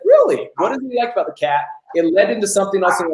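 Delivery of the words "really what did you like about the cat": a sudden high "Really?" then a quick, curious question; curious surprise. Shown as a demonstration. Coach showing a client how to ask about their cat, with bright curious surprise. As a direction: sound genuinely intrigued, not mocking. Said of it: "I just said".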